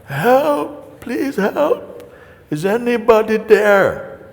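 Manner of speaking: high, hammed-up calls for help; mock desperate shouting. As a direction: call out desperately for any rescuer. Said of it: "He's shouting".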